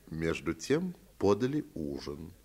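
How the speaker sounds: ordinary delivery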